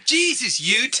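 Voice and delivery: put-on loud, high-pitched botched Aussie accent; bad Australian accent. Mocking an actor who can't do Australian, bad Aussie accent. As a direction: cry out in a badly faked Australian accent. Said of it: "can't do an Australian accent".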